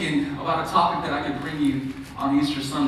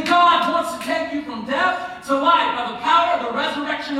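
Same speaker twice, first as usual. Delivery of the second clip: raised, emphatic pulpit delivery; forceful preaching; 7 semitones higher, 4 dB louder than usual. Real, not acted. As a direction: preach it with rising, driving energy.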